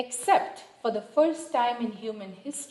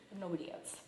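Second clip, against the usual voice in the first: hoarse, fading voice; raspy whisper; 2 semitones lower, 16 dB quieter, much faster than usual. Real, not acted. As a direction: voice giving out, raspy. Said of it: "Sorry, I'm losing my voice".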